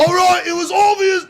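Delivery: very high shouted Scottish outburst; Begbie. Shown as a demonstration. Trying a film character for friends, shouted Scottish impression. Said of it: "Let me try Begbie".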